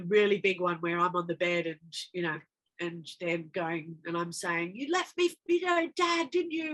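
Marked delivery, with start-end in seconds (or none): 4.7-6.7 high-pitched mock screaming at her mother; a half-hearted re-creation of an anguished accusation; retold scream. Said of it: "And I'm screaming at her".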